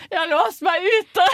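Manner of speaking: high, loud and slow through the glass; locked-out mother shouting to her daughter. Retold or imitated. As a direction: call out through a window, stretched and loud.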